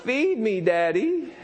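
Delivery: put-on high-pitched little boy; toddler demand. Toddler asking dad for breakfast, high mock plea. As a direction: pipe up like a toddler wanting help. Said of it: "And he's like".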